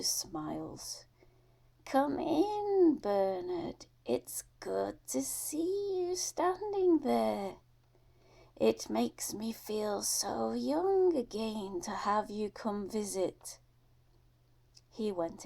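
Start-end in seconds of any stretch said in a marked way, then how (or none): 1.8-13.6 put-on a higher, airy old woman's welcome; sweet old lady. Old Missus Drew welcoming Bubba at her door, higher fond voice. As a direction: coo a fond greeting to the boy.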